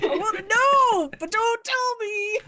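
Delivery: high-pitched playful whine; torn, mock pleading. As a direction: plead as if bursting with curiosity. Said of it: "So I'm just like".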